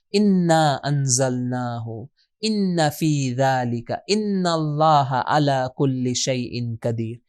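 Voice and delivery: very slow, measured recitation; unhurried verse reading, stretched syllables; Quran recitation. Shown as a demonstration. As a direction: recite with long, drawn-out vowels. Reciting Quran examples of the word.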